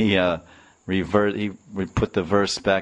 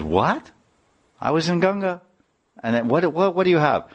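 much higher, disbelieving; astonished husband; 9 semitones higher than usual. Retold or imitated. Husband told of the gifts, astonished. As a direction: cry out in disbelief.

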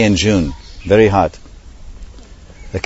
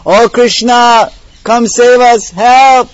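much higher, louder desperate cry; cry for help; 12 semitones higher, 6 dB louder, slower than usual. Acted. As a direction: scream for Krishna to save them. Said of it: "they cried for help".

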